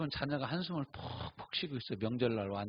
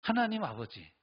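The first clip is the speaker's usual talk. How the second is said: pleading, slightly raised voice; imitated bold prayer; 4 semitones higher, 5 dB louder than usual. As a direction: demand an answer from God. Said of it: "이렇게 기도하는 거예요". (떼쓰듯 기도하는 흉내)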